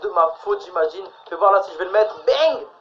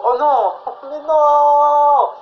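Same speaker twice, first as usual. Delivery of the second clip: dismayed laughing; 8 semitones higher, 6 dB louder, much slower than usual. Real, not acted. Shot just missed in match.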